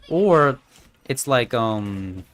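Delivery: ordinary delivery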